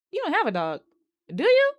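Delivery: climbing up to a loud, very high do you; suspicious realization. Retold or imitated. Brad realizing the dog story was a lie, rising shock. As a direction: let the question shoot up in disbelief.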